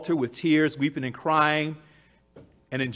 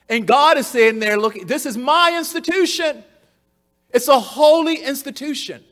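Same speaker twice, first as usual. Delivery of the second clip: fervent preaching; 6 semitones higher, 4 dB louder than usual. Acted. Preaching at full force.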